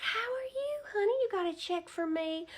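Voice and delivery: very high, sugary grandma greeting; high doting old-lady voice; sweet old lady voice. Retold or imitated. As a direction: coo at him like a doting granny teller. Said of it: "And she's like".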